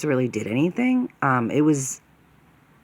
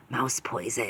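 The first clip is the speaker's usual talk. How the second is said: menacing mutter; 3 semitones lower, 9 dB quieter than usual. Retold or imitated. Neighbour answers with wicked look.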